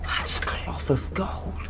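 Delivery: slow, hushed whisper; awed whisper. Acted. Boy marvelling at the chest's treasure, whispering slowly. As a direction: breathe it in wonder.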